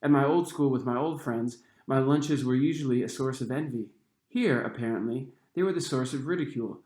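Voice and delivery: ordinary delivery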